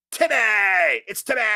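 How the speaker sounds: high, excited announcement of a name; excited high greeting; hyped name shout-out. Real, not acted. Calling out a viewer's name.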